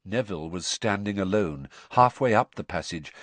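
usual speaking voice

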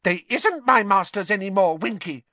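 high elf voice, firm and defiant; a small elf standing his ground; squeaky elf, defiant; defiant house-elf. A narrator's reading. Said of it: "said Dobby defiantly".

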